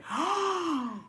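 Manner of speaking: drawn-out, high gasp; big storytelling gasp; sharp gasp. Retold or imitated. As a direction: gasp in mock shock. Mimes reaction within her story.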